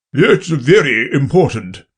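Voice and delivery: booming king. Acted. King speaks to jury.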